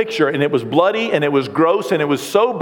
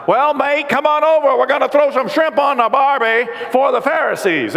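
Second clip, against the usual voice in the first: higher-pitched mock Australian accent; 6 semitones higher than usual. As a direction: put on a playful, broad Australian accent. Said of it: "he does it in an Australian accent".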